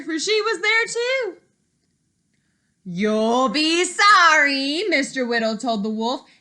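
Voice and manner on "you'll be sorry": woodcutter. Acted. Woodcutter threatening wolf.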